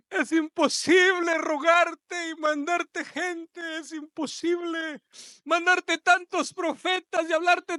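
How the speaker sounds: high, loud pleading cry, slowing down; impassioned preaching. Acted. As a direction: plead with the people as if heartbroken. God's lament voiced in sermon. (clamor apasionado y lento)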